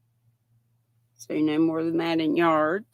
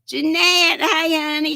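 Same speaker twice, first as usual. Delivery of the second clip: excited greeting; 9 semitones higher, 5 dB louder than usual. Real, not acted. Greeting live viewer.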